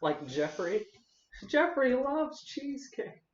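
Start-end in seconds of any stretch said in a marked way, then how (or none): 1.5-3.2 very high, slow stretched cartoon voice; silly voice. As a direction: draw out Jeffrey like a cartoon. Doing a silly voice.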